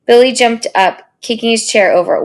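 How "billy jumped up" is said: usual speaking voice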